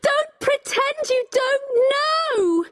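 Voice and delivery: high-pitched, heated cry; crying out accusingly. A narrator's reading. Becky to Richard, crying out in outrage. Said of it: "Becky cried".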